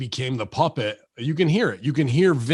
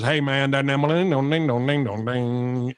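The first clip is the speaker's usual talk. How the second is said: droning singsong Southern twang gibberish; mock Southern accent. Mocking how Northerners hear Jim Ross, mock Southern accent. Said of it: "they just hear Jim Ross is".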